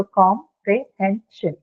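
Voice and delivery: "comprehension" slowly split into four separate syllables. Shown as a demonstration. Teacher counting out four syllables for students, syllable by syllable. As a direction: break "comprehension" into com, pre, hen, shun. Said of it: "four syllables, right".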